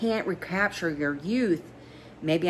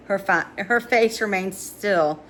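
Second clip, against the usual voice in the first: ordinary delivery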